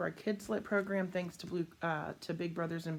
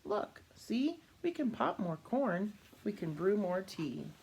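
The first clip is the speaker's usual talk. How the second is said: much higher little mouse voice, comforting; a tiny mouse soothing the bear; squeaky mouse; 4 semitones higher, slower than usual. Acted. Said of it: "the mouse squeaks".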